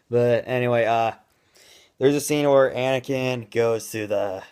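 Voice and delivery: ordinary talk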